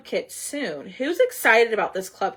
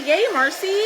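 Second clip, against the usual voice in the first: excited; 9 semitones higher, 5 dB louder than usual. Real, not acted. Cheering viewer's kit arrival.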